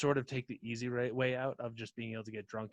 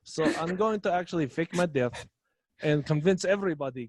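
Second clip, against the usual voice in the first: mock Russian Rasputin; 3 semitones higher, 6 dB louder than usual. Rasputin plans to fake death.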